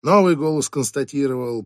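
usual speaking voice

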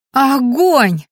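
loud, high, thrilled cry; delighted. A narrator's reading. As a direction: burst out in delight. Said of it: "восхитилась Лена". (восторженный возглас)

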